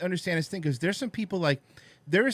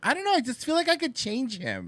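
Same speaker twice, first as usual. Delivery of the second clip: put-on higher dreamy girl voice; lightly voiced smitten girl; mock smitten girl; 7 semitones higher than usual. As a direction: lovestruck girl sure she can fix him. Imitating smitten girls.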